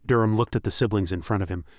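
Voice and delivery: ordinary delivery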